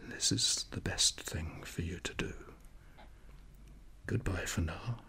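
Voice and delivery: quiet whispered farewell. A narrator's reading. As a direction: whisper by a sleeping patient's door. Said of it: "Doctor Gotthard spoke in a whisper".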